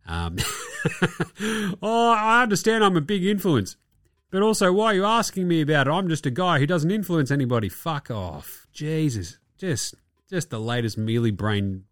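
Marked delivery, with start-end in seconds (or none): 0.4-1.8 short burst of high laughter. Real, not acted. Host laughs at Kyrie's excuse, high-pitched laughing. 1.8-7.7 high-pitched mock protest; mocking imitation. Host mimics Kyrie dodging questions about the movie, mocking.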